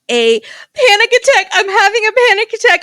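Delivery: ugly crying, high and loud; sobbing through a panic attack; snotty crying. Real, not acted. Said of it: "I am ugly crying right now".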